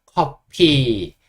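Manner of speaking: 커피 drawn out very slowly, loud, with an F; English F sound. Shown as a demonstration. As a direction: stretch 커피 slowly, F in place of p. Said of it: "F 소리만 좀 잘해주시면".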